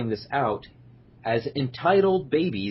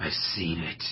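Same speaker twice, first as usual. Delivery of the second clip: low, soft brooding Batman voice; Dark Knight voice; 6 semitones lower, 5 dB quieter than usual. Pretend. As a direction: growl it like Batman. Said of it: "Dark Knight voice".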